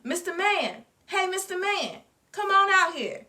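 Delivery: put-on high, loud child's yell; Blinky yells for the neighbor to come help. A narrator's reading. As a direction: call him out of the basement. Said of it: "Blinky yell".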